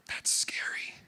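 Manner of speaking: unvoiced hush; quiet fearful whisper; whispered confession. Real, not acted. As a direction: breathe it like a secret. Confessing fear of wholeness.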